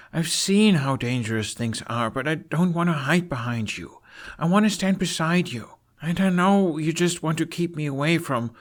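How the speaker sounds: high, pleading apology; earnest Hiccup. Acted. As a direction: beg your father to let you help.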